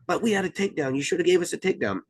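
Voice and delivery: high, fast protesting coach voice; a coach arguing to the official that his wrestler earned a takedown; complaining coach. Retold or imitated. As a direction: rattle it off like an aggrieved coach, pitched high. Angry coach voiced in retelling.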